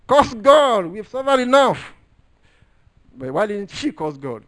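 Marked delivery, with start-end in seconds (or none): 0.1-1.9 loud, high shout of despair; wife's desperate outcry. Retold or imitated. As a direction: cry it out, loud and high, at the end of her rope. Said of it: "He said".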